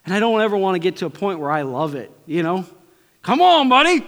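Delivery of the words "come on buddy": much higher, loud exclamation; preacher playfully calling out to the crowd; playful shout. Real, not acted. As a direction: throw it out with a grin.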